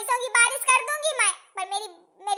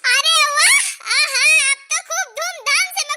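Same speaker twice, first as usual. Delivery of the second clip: much louder, higher overjoyed cheering; joyful shout; 3 semitones higher, 10 dB louder than usual. Acted. Mother-in-law shares news of a baby boy, overjoyed. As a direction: burst in with the happy news.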